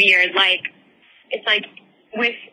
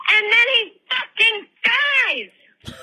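dramatic; 11 semitones higher, much slower than usual. Real, not acted. Climax of the creepy story.

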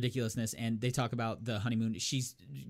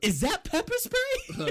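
very high, slow incredulous question; high-pitched retold outcry; incredulous mock squeal; 4 dB louder, slower than usual. Acting out incredulous victim.

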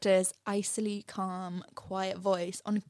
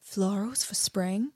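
dry sarcasm. Retold or imitated.

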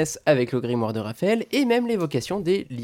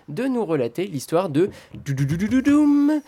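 drumroll; 5 semitones higher than usual. Acted. Suspense drumroll.

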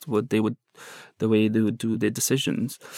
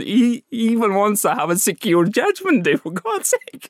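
amused disbelief; 9 semitones higher than usual. Retold or imitated. Amazed aside about captor.

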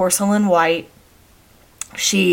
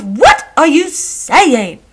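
put-on slow, high-pitched English-accented indignation; irate English accent; 7 semitones higher, 4 dB louder, much slower than usual. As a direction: demand it like an outraged English mother, stretching each word. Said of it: "She's always irate".

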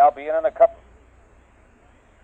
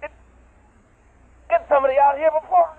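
panicked screaming; 11 semitones higher, 4 dB louder than usual. Acted. Trapped, being digested.

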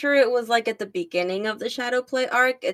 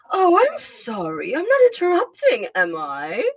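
haughty Starscream voice; 7 semitones higher than usual. Shown as a demonstration. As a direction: sneer it like a smug aristocrat. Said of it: "a very haughty voice".

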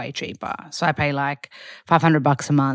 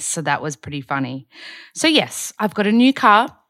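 normal talking voice

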